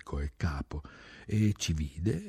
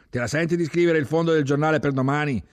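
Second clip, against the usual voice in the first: loud, fast, higher challenge; brisk command question; 10 semitones higher, 7 dB louder, faster than usual. Acted. Chief asks Zaccheo to write the editorial, loud and fast. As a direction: fire the question at him.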